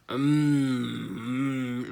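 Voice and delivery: long, loud exaggerated 'mmm'; mock humming. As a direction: imitate overdone food moans. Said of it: "like that".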